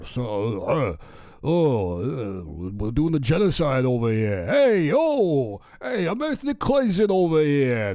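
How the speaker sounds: hammed-up New York accent, slow and drawn out; Netanyahu as Rodney Dangerfield. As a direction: do a broad Brooklyn comic routine. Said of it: "I gave him kind of a Rodney Dangerfield".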